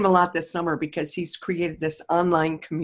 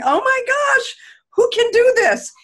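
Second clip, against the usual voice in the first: much higher, louder, gasping with wonder; amazed exclamation; 5 dB louder than usual. Retold or imitated. Grandmother marvels at grandson's schematic, amazed and high. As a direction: sound blown away by the drawing. Retelling her amazed reaction.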